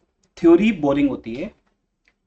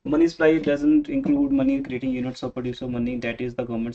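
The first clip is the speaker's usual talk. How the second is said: mock rote reading; 2 semitones lower, much slower than usual. Shown as a demonstration. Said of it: "पढ़ के एक line खत्म कर देते हैं".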